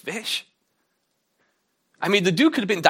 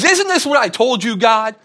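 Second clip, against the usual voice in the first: loud, much higher indignant cry; angry Jonah; 8 semitones higher, 9 dB louder than usual. Retold or imitated. Jonah shouts angrily at God. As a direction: throw it at God in outrage. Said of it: "he gets so angry with God".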